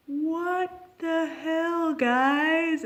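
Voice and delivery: slow, high, drawn-out what the hell; imitating disbelief. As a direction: play the stunned friends, stretching every word. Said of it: "they're like".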